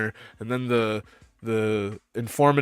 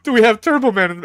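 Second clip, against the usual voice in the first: mocking store clerk; 9 semitones higher, 5 dB louder than usual. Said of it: "they're like".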